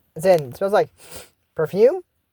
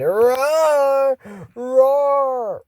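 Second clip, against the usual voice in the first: high, hammed-up roar; monster roar; 6 semitones higher than usual. Kids fake a monster.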